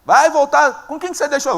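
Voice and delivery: imitação de irmão bravo, voz mais alta; irmão com raiva. Retold or imitated. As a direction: cobrar irritado, imitando um irmão mais velho, em tom agudo. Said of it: "O irmão dele fica com raiva". (com raiva, encenado)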